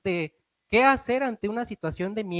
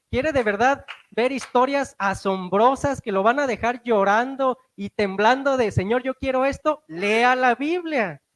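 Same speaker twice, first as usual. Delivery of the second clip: loud fervent preaching, last phrase very slow; 3 semitones higher, 5 dB louder than usual. Real, not acted. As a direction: drive the point home, slowing on the end. Preaching at full force. (predicando con fervor, final muy lento)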